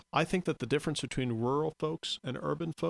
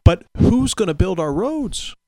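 mock worried citizen; 8 semitones higher, 10 dB louder than usual. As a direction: ham up the worried question. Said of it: "people often say to me is".

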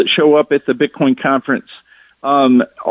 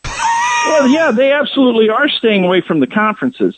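emphatic protest; 9 semitones higher than usual. Real, not acted. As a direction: shout it, defending your claim. Pushed back on by host.

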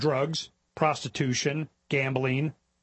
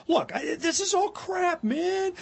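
fed-up retort; 12 semitones higher, slower than usual. Retold or imitated. Telling lawyers off, retold.

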